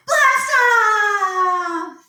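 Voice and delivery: high excited shout. Acted. Countdown ends in launch.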